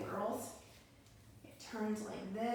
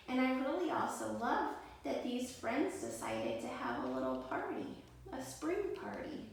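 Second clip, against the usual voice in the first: usual speaking voice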